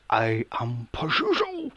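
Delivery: a hint of a possessed boy, slow; demon voice. Possessed boy announcing the demon, lightly voiced slow menace. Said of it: "the little boy goes".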